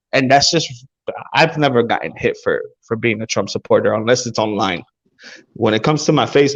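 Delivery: ordinary delivery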